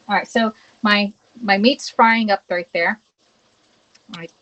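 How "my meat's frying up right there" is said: ordinary delivery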